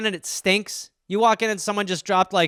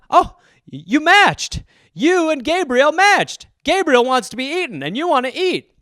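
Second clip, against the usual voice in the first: higher, bright chirpy app announcer, slowed; 8 semitones higher, 5 dB louder than usual. Acted. Dating app chirps to user about match.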